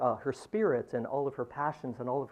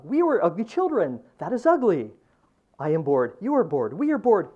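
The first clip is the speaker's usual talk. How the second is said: loud, rising chant of repeated lines; poetry reading; 9 dB louder than usual. Acted. Poet reads his translation to the audience, declaiming. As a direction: read each variation louder, building.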